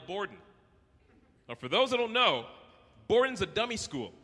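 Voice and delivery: ordinary talk, nothing marked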